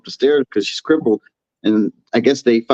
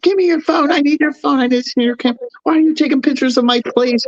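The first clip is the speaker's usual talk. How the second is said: very high-pitched frantic imitation; high, agitated demands without shouting; mock freak-out. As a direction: imitate someone panicking, voice shot way up high. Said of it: "she starts freaking out".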